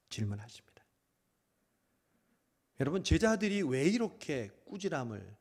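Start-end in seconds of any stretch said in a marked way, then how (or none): 0.1-0.8 whisper. Real, not acted.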